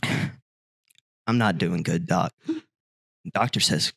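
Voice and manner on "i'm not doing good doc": mock despair. Moth's sad confession in joke.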